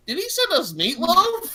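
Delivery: high, loud incredulous question; baffled first reaction. Retold or imitated. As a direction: retell it in mock bewilderment. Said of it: "my first reaction was".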